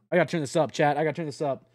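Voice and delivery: ordinary delivery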